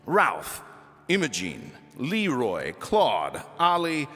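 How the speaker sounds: usual speaking voice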